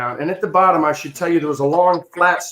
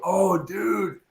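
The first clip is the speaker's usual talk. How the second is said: higher, hushed "oh, dude" of onlookers; sympathetic onlookers; 5 semitones higher, 3 dB quieter than usual. Retold or imitated. Onlookers to kid with smashed sled, pained sympathy. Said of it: "everybody's going".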